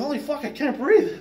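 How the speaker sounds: retold breathless panic. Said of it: "then I'm thinking".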